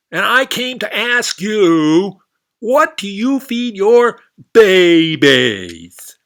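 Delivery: very high, loud, slow frog rambling; a brash frog blundering on; loud frog babble. Acted. Frog greeting the alligator, very loud and high.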